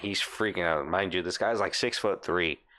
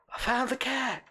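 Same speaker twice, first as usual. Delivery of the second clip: faint distant call. Retold or imitated. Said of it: "like, real faint".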